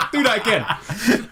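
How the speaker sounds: high laugh into a drawn-out request; giggle, then beg the robot for an encore; imitating giddy, slow, high delight; amused delight. Retelling delighted teasing of the robot.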